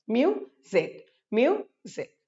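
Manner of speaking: "म्यूजिक" broken into myu, pause, zik; split syllables. Shown as a demonstration. Teacher drilling two-syllable words, "म्यूजिक" split as myu - zik. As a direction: say each "म्यूजिक" in two separate beats. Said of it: "दो syllable से बने हैं".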